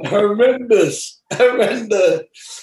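slow, high, laughing. Real, not acted. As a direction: stretch it out while laughing. Laughing at the memory.

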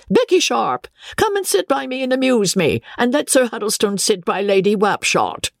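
put-on high, shrill old woman calling out; shrill old lady. Old lady at dinner, calling Becky shrilly. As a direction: cry out high and imperious. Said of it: "cried out in a shrill voice".

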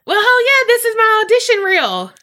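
high, loud and thrilled; loud, high-spirited brag; excited. Real, not acted. Playful boast after the compliment.

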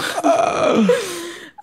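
high bursting laugh at herself; amused laugh. Real, not acted. Laughing at the idea of herself in the army, a high real laugh. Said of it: "Can you imagine me in the army".

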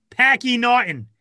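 high, loud Boston take on Packy Naughton; Boston accent. Pretend. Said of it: "you got it better".

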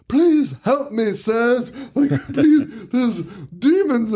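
high, slow, pleading little voice; mock-pleading voice, high and slow, breaking into laughter; whiny plea. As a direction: beg for help in a high, pitiful voice. Pleading Nigel voiced in retelling.

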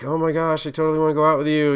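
high, fast, breathless mock gush; gushing eager date. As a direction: play the overeager suitor gushing fast. Mocking overeager dates.

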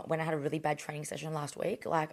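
ordinary delivery

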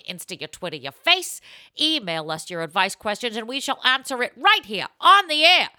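put-on loud, high, pushy old-time ad voice; hammed-up ad read. Host plugs the show like an old toothpaste ad. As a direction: sell it like a fifties toothpaste ad.

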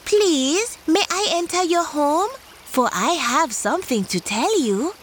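high, sweet-talking tortoise voice; a sly, polite tortoise asking to come in. Acted. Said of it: "she said".

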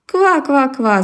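croaking frog laugh. Acted. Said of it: "засмеялась лягушка".

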